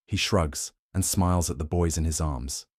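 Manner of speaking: ordinary delivery, nothing marked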